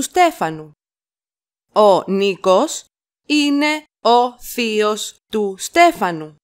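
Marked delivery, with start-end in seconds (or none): 1.7-6.4 slow it down for learners; slow chunked repeat. Shown as a demonstration. Teacher to learners, slow chunked model. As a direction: pause after Νίκος, then the rest. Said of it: "teacher repeats in chunks".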